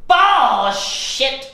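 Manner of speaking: very loud, high, drawn-out yell; shouted dismissal. Acted. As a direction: bellow it, stretching the first syllable. Repeats it louder after "What?.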